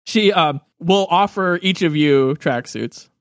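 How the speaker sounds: usual speaking voice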